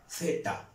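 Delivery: Z as a soft voiceless hiss; teacher repeats the Z hiss softly. Shown as a demonstration. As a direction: breathe out a quiet Z hiss. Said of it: "¿Qué órganos se juntan".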